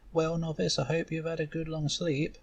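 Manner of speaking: a tutor pushing a sleepy novice; impatient mentor. Acted. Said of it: "She looks impatient".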